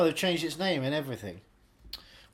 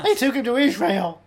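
mock rant; 7 semitones higher, 10 dB louder than usual. Continues loud mock rant.

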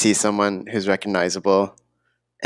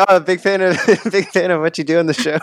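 laughing retelling; 7 semitones higher than usual. Retold or imitated. Laughing through retold awkward compliment.

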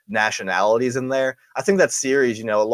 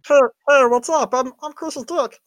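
Meatwad voice; 13 semitones higher, 4 dB louder than usual. Pretend. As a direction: pipe up as a talking dick. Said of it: "You guys hear a muffled voice?".

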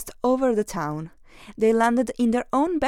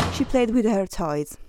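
ordinary delivery, nothing marked

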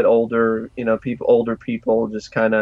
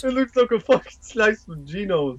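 put-on higher, tough-guy New York accent; Rocky New York accent; 8 semitones higher than usual. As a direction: echo the bit in a brash New York accent. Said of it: "It kind of sounds like Rocky".

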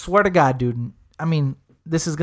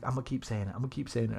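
quiet aside; 5 semitones lower, 14 dB quieter, much faster than usual. Retold or imitated. Corey quoted murmuring aside.

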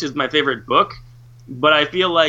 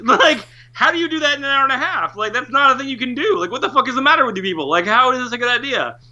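higher-pitched, incredulous rant at the filmmakers; exasperated rant; 6 semitones higher than usual. Retold or imitated. Fan ranting at the filmmakers, incredulous and exasperated. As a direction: vent disbelief about the cut-down movie. Ranting about film adaptation.

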